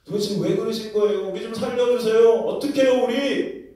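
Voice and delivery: high, loud panicked begging; desperate plea. Acted. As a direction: beg for rescue in terror. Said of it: "불평, 불만을 막 쏟아냈을". (높고 크게 애원하는 목소리)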